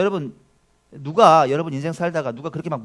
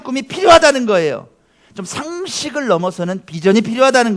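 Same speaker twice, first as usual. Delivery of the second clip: loud, raised sermon climax; full-force preaching; 8 semitones higher, 11 dB louder than usual. Acted. Pastor exhorts the church about big dreams, preaching at full force. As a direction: drive the point home to the congregation.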